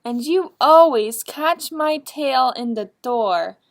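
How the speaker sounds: loud, whiny complaint; complaining. Acted. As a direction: really let him have it. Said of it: "complained little Shadow".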